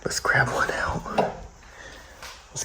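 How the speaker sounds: whisper. Real, not acted. Sneaking through abandoned factory.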